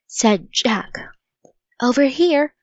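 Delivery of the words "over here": louder, higher call across the dark. A narrator's reading. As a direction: call out to him from a distance. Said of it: "she called".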